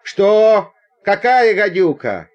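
alarmed, loud, high questioning; startled questions. Acted. Passenger hearing of a viper in the carriage, startled.

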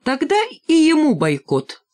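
high, emphatic ruling; decisive verdict. A narrator's reading. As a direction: pronounce the sentence on him. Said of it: "решила железная кнопка". (решительно, высоким голосом)

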